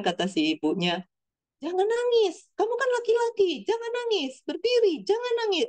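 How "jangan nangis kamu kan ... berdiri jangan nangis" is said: high-pitched, urgent imitation of a mother; high, hurried retold scolding; wrong scolding tone. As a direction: voice a mum telling her boy off, pitched way up. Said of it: "Nadanya nggak kayak gitu, maaf".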